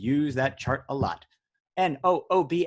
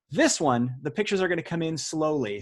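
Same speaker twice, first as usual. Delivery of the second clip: ordinary talk, nothing marked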